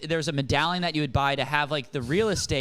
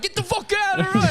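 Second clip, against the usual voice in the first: very high, loud mock shout; mock cabbie yell; 4 dB louder than usual. Cabbie yells at the pedestrian, high hammed-up shout. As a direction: scream it like a furious cabbie.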